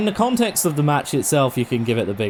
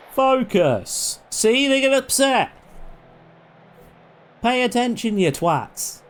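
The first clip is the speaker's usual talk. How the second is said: yelling at the team; 11 semitones higher, slower than usual. Real, not acted. Yelling at players in game.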